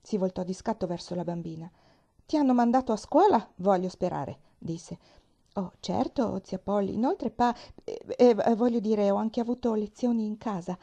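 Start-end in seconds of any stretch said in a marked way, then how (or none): 2.3-4.4 lightly voiced stern aunt, a touch higher and louder; a hint of a strict old aunt questioning. Stern aunt Polly questions her niece, clipped and firm. 5.5-10.8 put-on lightly raised girlish voice; a hint of a chatty young girl; eager little girl. Pollyanna answers her aunt, eager and a little flustered.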